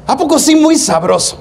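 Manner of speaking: high, teasing street voice; barrio slang. Acted. A hint of a neighborhood guy mocking the faithful. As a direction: tease like a guy from the block. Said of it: "porque yo soy de barrio".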